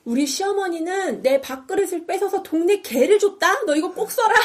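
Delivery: animated imitation of a complaining auntie; aggrieved auntie venting. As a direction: air an old grudge as a mock auntie. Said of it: "이런 이야기들을 하는 거야".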